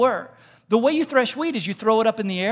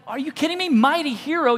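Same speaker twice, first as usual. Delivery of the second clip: high, disbelieving question; can't believe what he's hearing; mock-stunned disbelief; incredulous; 6 semitones higher, 3 dB louder than usual. Gideon incredulous at angel.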